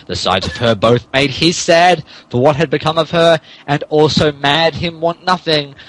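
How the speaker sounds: ordinary talk, nothing marked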